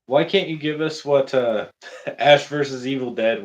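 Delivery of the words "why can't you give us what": ordinary talk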